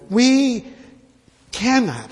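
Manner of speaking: CANNOT set apart by a pause and pitched high; emphatic stress. Real, not acted. Preacher telling believers they cannot sin carelessly, emphatic stress on CANNOT. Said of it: "how can I emphasize that word cannot".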